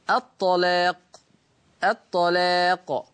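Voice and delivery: slow الطلاق with a thick, dark لام; teacher lets the class hear the heavy lam. Shown as a demonstration. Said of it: "سامعين صوت التغليظ لللام".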